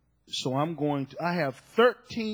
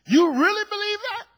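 incredulous; 13 semitones higher, 5 dB louder than usual. Real, not acted. Mocking disbelief at power claims.

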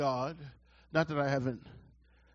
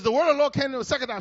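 scripture read; 8 semitones higher, 7 dB louder, much faster than usual. Real, not acted. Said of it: "It says".

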